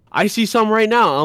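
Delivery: high, loud, teasing; smug coworker. Retold or imitated. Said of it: "And he's like".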